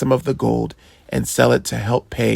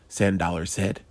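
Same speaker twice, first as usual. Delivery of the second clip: ordinary delivery, nothing marked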